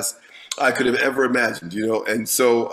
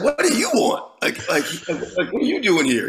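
irritated challenge; 4 semitones higher than usual. Retold or imitated. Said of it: "he's like".

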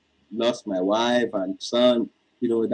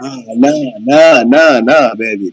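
loud, urgent repeated no; talking over a bad line, pushing the denial hard; loud insistence; 10 dB louder than usual. Real, not acted. Caller insisting loudly to his sweetheart over the phone, loud.

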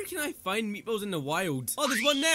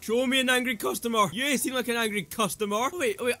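ordinary talk

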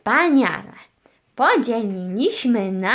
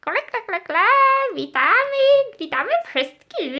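high stork voice with clacking kle-kle; chirpy stork puppet; 12 semitones higher, faster than usual. Pretend. Stork welcomes all the viewers, high puppet voice. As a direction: play a high-voiced stork greeting everyone.

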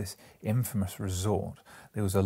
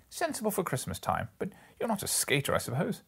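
higher, chatty gentleman voice; light polite small talk, raised; businessman small talk; 10 semitones higher than usual. Acted. One merchant asking the other about skating, higher polite voice.

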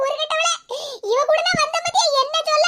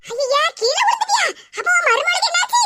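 ordinary talk

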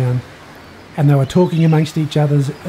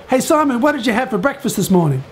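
higher-pitched calling out, no real shout; pitched-up, lively call; mild mock yell; 7 semitones higher than usual. A narrator's reading. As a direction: call it across a boat without full volume. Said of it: "someone yelled out".

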